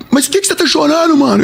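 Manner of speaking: high, whiny imitation of crying; tearful. Crying stranger asks the singer what's wrong, tearful. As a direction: wail the question in mock tears. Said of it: "os dois chorando".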